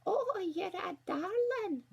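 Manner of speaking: high, slow put-on granny voice; wolf sweetly faking a granny, drawn out; a wolf disguised as a frail granny, high and slow. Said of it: "the wolf said".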